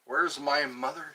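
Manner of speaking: ordinary talk, nothing marked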